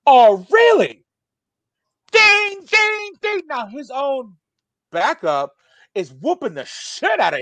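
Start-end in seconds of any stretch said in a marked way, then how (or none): 0.1-1.1 loud, high, stretched-out mock incredulity; acted dad's challenge. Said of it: "my dad says this". 2.1-3.4 high ringing dings; imitated fight bell.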